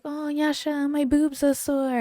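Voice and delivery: high whiny mock voice, drawn out; mocking whiny coworker. Mocking a needy coworker to his face, high and whiny. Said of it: "you come in and be like".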